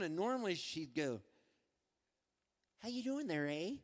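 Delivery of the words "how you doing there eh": higher mom voice with a Canadian lilt; Canadian accent. Acted. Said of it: "'Cause she had a Canadian accent".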